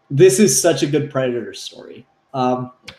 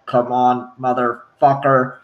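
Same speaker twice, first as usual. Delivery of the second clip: low, slow mimicked replay; Predator voice playback; 6 semitones lower, slower than usual. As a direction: repeat it like a creature replaying captured words. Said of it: "use the voice capture and play it back".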